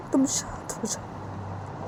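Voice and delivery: slow, hushed whisper; soothing. Acted. Uma calming crying Sanju, soothing whisper. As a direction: hush someone tenderly, almost voiceless. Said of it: "Sanju को शांत करवाने लगती है".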